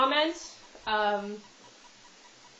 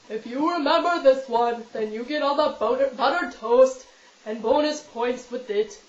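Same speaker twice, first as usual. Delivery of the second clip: loud, put-on cartoon character voice; 6 dB louder than usual. Shown as a demonstration. Vlogger quizzing viewers on a childhood cartoon, in the character's voice. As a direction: do a big goofy cartoon voice. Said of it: "I can do one cartoon character very well".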